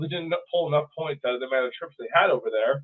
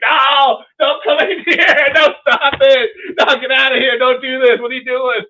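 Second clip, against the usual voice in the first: hammed-up cries to keep attackers out; mock desperate shouting; 10 semitones higher, 11 dB louder than usual. Host voices French defenders under siege, mock desperation.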